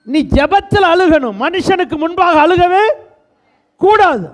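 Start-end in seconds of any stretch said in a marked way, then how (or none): none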